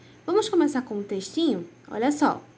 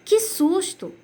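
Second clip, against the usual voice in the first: startled exclamation; 8 semitones higher, much slower than usual. Shown as a demonstration. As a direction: say it like something just jumped out. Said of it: "um tom de susto".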